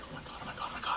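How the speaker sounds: breathless whispered oh my god; panicked whisper. Retold or imitated. Shy fan two tables away from his idol, whispering. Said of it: "I'm just kinda like".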